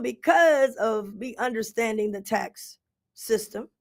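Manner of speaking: usual speaking voice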